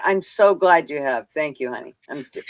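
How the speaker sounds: ordinary talk, nothing marked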